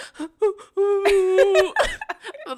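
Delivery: loud, high burst of laughter; high laugh while describing sobbing. Real, not acted. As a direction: crack up at yourself. Describing own ugly sobbing.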